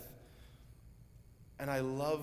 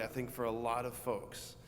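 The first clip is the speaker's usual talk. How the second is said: ordinary talk, nothing marked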